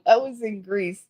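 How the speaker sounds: usual speaking voice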